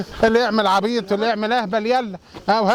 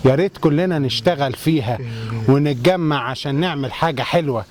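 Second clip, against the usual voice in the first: ad read; 7 semitones lower than usual. Acted. Reading ad copy.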